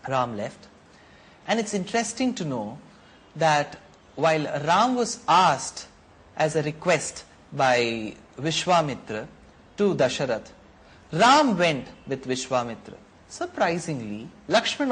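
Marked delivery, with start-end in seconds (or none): none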